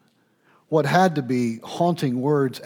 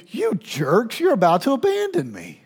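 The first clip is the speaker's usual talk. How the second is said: high-pitched, fast reproach that climbs on "you're about to abandon me"; mock accusation; 8 semitones higher than usual. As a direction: play the wounded friend throwing blame. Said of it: "What he's doing is not saying".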